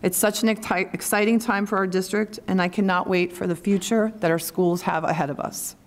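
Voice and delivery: ordinary delivery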